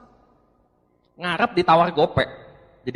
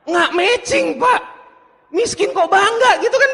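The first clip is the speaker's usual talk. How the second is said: higher and louder, laughing in disbelief; can't believe what you're hearing; incredulous laughing outrage; 11 semitones higher, 5 dB louder than usual. Real, not acted. Presenter to audience, laughing in disbelief at a proud broke prospect.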